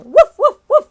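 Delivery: high loud woof woof woof; dog barking. Shown as a demonstration. Teacher hinting to kids with a playful dog bark. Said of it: "and I'll say".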